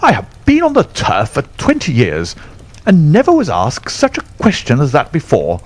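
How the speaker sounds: high, indignant character voice, only mildly angry; angry Colonel. A narrator's reading. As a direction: raise the pitch in affronted protest without shouting. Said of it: "The Colonel was very angry".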